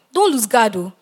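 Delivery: ordinary delivery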